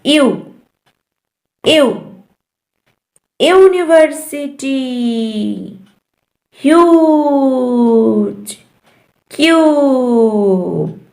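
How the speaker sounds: "यू" as "you", the letter's name; long U sound. Shown as a demonstration. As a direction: stretch the vowel of "ह्यूज" very slowly. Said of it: "long sound क्या है?".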